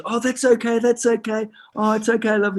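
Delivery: high-pitched imitated woman; lady brushing off an apology; reassuring lady. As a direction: soothe him in a put-on high voice. Acting the lady reassuring him.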